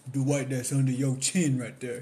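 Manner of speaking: mock comedian punchline; loud punchline. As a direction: land the joke hard. Punchline of retold bit.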